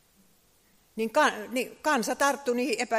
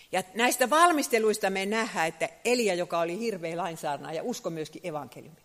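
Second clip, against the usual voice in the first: ordinary talk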